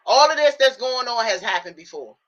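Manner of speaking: high, firm telling-off; scolding herself. Retold or imitated. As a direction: get yourself straight, firmly.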